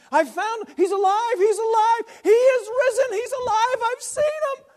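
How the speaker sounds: overjoyed woman crying out that he lives; ecstatic news. Acted. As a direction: burst in shouting the good news. Mary runs to tell disciples.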